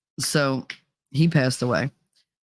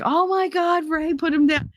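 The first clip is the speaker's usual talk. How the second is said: alarmed parents; 12 semitones higher than usual. Retold or imitated. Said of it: "they're all just like".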